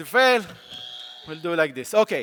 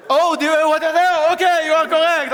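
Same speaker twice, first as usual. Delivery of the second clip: 1 astonished; 8 semitones higher, 7 dB louder than usual. Real, not acted. Stunned by the kid's answer.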